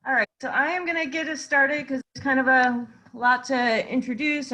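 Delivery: usual speaking voice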